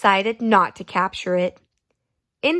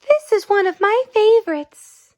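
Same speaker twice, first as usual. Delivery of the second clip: high, bright little sister voice, louder; little girl voice; 11 semitones higher, 5 dB louder than usual. Acted. Little sister showing her brother her drawing, high girl voice. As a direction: play the proud little sister.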